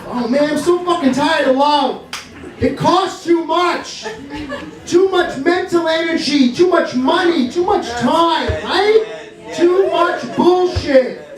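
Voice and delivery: high, drawn-out complaint; exasperated rant. Acted. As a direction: hammer each complaint in a high, fed-up voice. Rant about tiring love.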